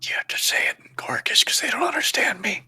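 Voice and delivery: high, fast whisper. Real, not acted. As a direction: hurry it out in a secret whisper. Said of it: "I think that whisper is to you".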